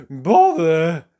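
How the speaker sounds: sharp loud "Bother!" in a high character voice; annoyed exclamation. Acted. Mister Forgetful cursing after tripping over a sheep, loud annoyance. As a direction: blurt it out after a fall. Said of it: "said Mister Forgetful".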